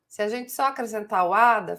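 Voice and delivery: usual speaking voice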